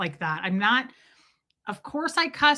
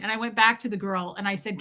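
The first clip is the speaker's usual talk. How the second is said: usual speaking voice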